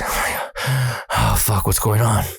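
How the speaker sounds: sighing, breathy alarm; breathless panic. Retold or imitated. Teller scaring himself in bed, mock breathless panic. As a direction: gasp out the words as if short of air. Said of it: "I can't breathe".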